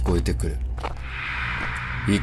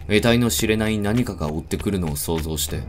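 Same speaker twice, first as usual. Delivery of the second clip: plain reading voice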